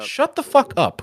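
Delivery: high, slow exasperated put-down; exasperated retort. Acted. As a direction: drag out the dismissal in a high voice. Snaps back at the imitated complainer.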